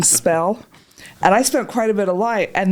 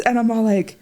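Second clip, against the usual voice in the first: ordinary delivery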